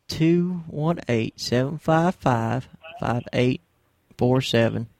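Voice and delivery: slow repeat. Shown as a demonstration. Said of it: "I better repeat the number here".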